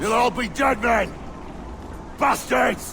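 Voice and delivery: raging bellow; furious shout. Acted. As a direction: scream the curse after them. Pirate yells after the soldiers.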